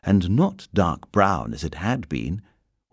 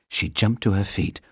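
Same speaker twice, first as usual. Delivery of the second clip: ordinary delivery, nothing marked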